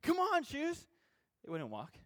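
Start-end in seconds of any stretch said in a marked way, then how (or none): 0.0-0.9 mock yell. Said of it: "If I started yelling at the shoes".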